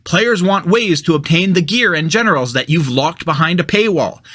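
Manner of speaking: ordinary talk, nothing marked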